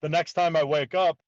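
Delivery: ordinary talk, nothing marked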